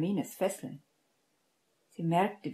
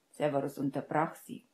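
usual speaking voice

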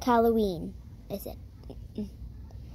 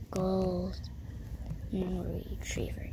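whispering; 3 semitones lower, 10 dB quieter, slower than usual. Real, not acted. Whispering her answer.

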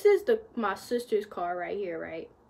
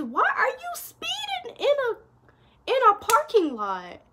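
exasperated rant; 12 semitones higher, 4 dB louder than usual. Retold or imitated. Ranting at the speeding driver.

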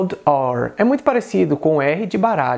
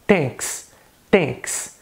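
"thanks" starting with T for TH; Indian English accent; 3 dB quieter than usual. Shown as a demonstration. Teacher showing the dropped TH, Indian accent. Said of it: "no inglês indiano".

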